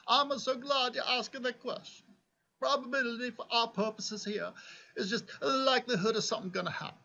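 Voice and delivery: put-on cheesy Italian accent, pitched high, laughing at the end; terrible fake Italian accent. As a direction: play a jokey Italian professor with a thick put-on Italian accent. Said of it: "my terrible fake Italian accent".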